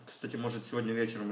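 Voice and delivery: chewing while talking; eating salad between words; mouth full. Real, not acted. Seen 3: bite at -2.0, still chewing early; later only picks salad.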